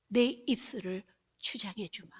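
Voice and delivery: hushed whisper of God's voice; whispered promise. Acted. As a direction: breathe it like a secret promise.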